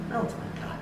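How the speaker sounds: very soft quiet "Na unsa man ka?"; hushed caring demo; gentle tone. Shown as a demonstration. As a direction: ask it softly with care. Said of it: "Listen to the difference here".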